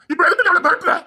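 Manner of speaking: angry shout. Acted. Quarrel over threatening the girl.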